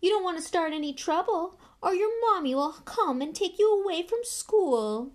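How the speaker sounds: high, taunting bully; loud sneering schoolboy taunt. Acted. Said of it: "sneered Malfoy".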